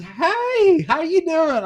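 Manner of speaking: high, drawn-out hello; mock-cheery greeting. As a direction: greet them high, as if nothing happened. Retold awkward next-day greeting.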